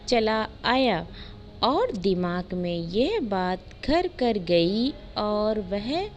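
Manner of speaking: usual speaking voice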